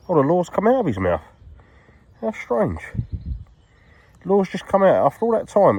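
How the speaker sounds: high, astonished exclamations; amazed, voice jumping right up; surprised. Real, not acted. Lure has fallen out of the pike's mouth.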